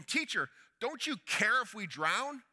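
loud, slow, exasperated plea; frustrated cry. Acted. Frightened disciples accuse the sleeping Jesus, loud and frustrated. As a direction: demand it loudly, slowly. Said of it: "This was a cry of frustration".

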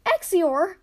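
high, loud outraged name; indignant cry. Acted. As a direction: call him out. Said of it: "she cried".

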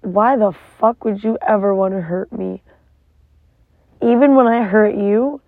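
ordinary talk

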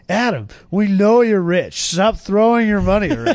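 high, loud teasing singsong; mocking taunt. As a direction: needle him in a high mock voice. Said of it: "he wanted to go".

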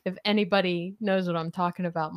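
ordinary delivery

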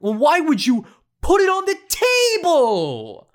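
high, loud incredulous retort; exasperated outburst. Retold or imitated. Caller snapping at airline agent, high and loud. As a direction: throw it back at him in disbelief. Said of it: "So already I'm mad".

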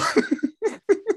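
real high-pitched burst of laughter. Real, not acted. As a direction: crack up at the uncle story. Laughing about rowdy uncle.

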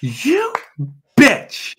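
mock outrage through laughter; laughing. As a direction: laugh out a fake insult. Laughing at ex's burn.

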